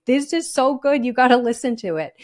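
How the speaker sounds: excited recommending. Retold or imitated. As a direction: burst out like a fan who can't wait to share it. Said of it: "because I'm like".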